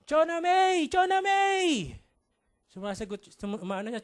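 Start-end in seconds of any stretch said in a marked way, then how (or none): 0.0-2.0 high, loud, drawn-out calling; frantic shouting. Acted. Mother running after her missing child, shouting frantically. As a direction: shout the name out high and long. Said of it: "sumisigaw siya, sabi niya".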